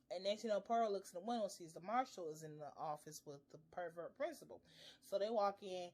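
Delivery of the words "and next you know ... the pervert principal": ordinary talk, nothing marked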